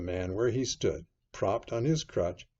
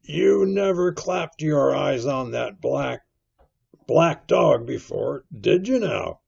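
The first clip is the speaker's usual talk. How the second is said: stern, higher-pitched landlord; 5 semitones higher, 8 dB louder than usual. A narrator's reading. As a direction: question him sternly, weighing each word. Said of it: "very sternly".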